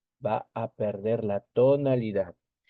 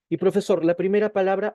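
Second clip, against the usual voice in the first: higher, louder eager student question; student asking; 8 semitones higher, 4 dB louder, faster than usual. Pretend. Teacher playing a student who calls out to the professor, eager. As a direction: pipe up like a pupil calling out to the teacher.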